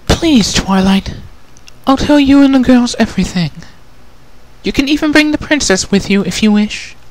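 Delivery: high, gentle refined lady, a touch sad; Rarity, sad. Acted. Rarity asks about her sister, gently sad.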